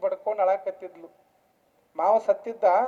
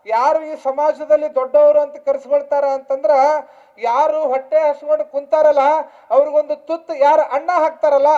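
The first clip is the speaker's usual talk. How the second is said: loud, raised, rhetorical exhortation; preaching; 5 semitones higher, 9 dB louder than usual. Real, not acted. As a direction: build the list forcefully, phrase by phrase. Preacher at full force. (ಎತ್ತರದ ಗಟ್ಟಿ ದನಿಯ ಉಪದೇಶ)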